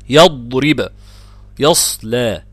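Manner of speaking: loud model recitation of يضرب and يصلى; tajweed demonstration. Shown as a demonstration. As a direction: lean on the heavy ض in يضرب and ص in يصلى. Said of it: "الساكن بعد الفتح".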